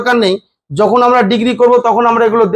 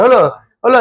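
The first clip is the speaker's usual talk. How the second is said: ordinary delivery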